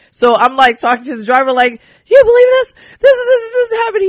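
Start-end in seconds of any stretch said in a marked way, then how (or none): 2.1-4.1 high, loud, breathless disbelief; disbelieving excitement. Retold or imitated. Telling the driver in the car, high excited disbelief. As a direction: squeal it out, can't believe it's real.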